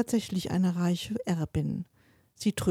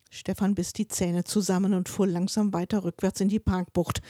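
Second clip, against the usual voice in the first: ordinary delivery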